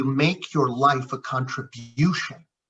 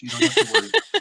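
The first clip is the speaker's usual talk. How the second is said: high, fast mock reassurance; much faster than usual.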